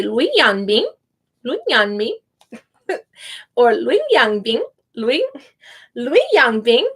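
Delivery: "Lui Yangbing" with the final G barely heard; name with a soft G. Shown as a demonstration. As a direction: say "Lui Yangbing" and let the G almost vanish. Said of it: "you almost don't hear the G".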